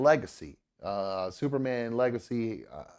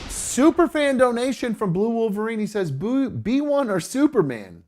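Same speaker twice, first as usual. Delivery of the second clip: high, loud excited readout; excited announcement; 12 semitones higher, 8 dB louder than usual. Real, not acted. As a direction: announce the donation with a shout. Reading out a donation excitedly.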